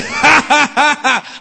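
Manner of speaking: high staccato belly laugh; demonstrated laughter. Shown as a demonstration. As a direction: launch straight into rolling ha-has. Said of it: "I tell you what I practice".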